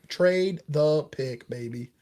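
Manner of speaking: very slow, deliberate answer; slow, emphatic verdict. Real, not acted. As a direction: draw out each word. Emphatic answer to either-or question.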